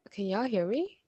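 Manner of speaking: normal talking voice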